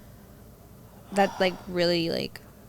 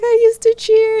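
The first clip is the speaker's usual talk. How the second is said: much higher, louder small voice; mock teary teen; 13 semitones higher, 8 dB louder than usual. Student imitating her younger self answering the counselor. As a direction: squeak it like a crying freshman. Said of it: "And I was like".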